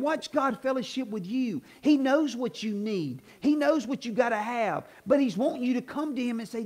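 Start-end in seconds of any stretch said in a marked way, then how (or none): none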